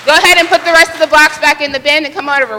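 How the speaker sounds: normal talking voice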